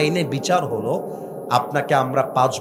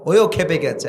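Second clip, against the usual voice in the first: normal talking voice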